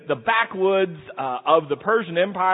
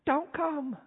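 high, much softer hushed plea; whispering; 10 semitones higher, 6 dB quieter than usual. Retold or imitated. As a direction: whisper it so God won't hear. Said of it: "I hear them whispering".

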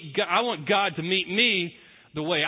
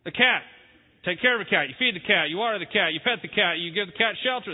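normal talking voice

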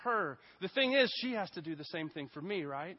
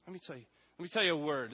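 ordinary talk

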